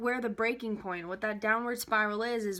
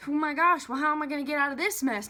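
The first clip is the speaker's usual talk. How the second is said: louder, higher, rushed mock worry; 5 semitones higher, 5 dB louder, faster than usual. Coach acting out doubt turning to worry, flustered and fast. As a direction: fret aloud as the problem snowballs. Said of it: "Now I'm worrying".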